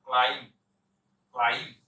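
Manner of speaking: ক্লাইম্ব ending on 'm', silent b; low, firm repeats of ক্লাইম্ব without the b; correct pronunciation. Shown as a demonstration. Teacher modelling the silent letter, correct pronunciation. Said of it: "বি উচ্চারণ করবেন না".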